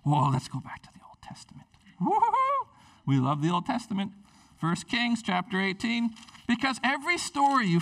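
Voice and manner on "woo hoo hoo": high gleeful woo-hoo; excited whoop. Real, not acted. Preacher cheering over the Old Testament, excited whoop. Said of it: "I got excited there".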